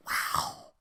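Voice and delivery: breathless whisper of disbelief; whispered wow. Real, not acted. Stunned by the streamer, a whispered wow.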